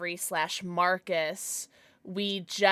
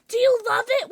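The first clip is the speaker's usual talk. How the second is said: breathy cutesy giggly; 13 semitones higher, 7 dB louder, faster than usual. Real, not acted. Asking a viewer excitedly.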